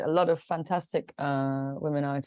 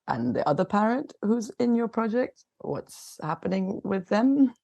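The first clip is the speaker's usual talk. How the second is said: pointed question; 6 semitones higher than usual. Retold or imitated. Retold pointed question to administrator.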